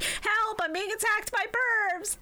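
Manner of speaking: high, slow hammed-up cry for help; mock damsel. English Taya being attacked, high mock squeal. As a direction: wail for help like a cartoon damsel. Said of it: "English Taya is like".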